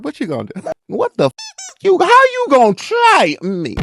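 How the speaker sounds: mock-confrontational, rising loud and high; loud, high-pitched acted outrage, stretched at the end; indignant challenge. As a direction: call out a boy who dared to test you. Acting out confronting staring boys.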